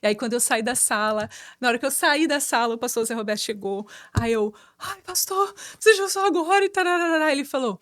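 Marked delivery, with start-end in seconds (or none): 4.8-7.3 high-pitched mock whining complaint; queixa encenada. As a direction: act out an exasperated reproach, much higher. Acted complaint to late pastor. (reclamação encenada, aguda e exasperada)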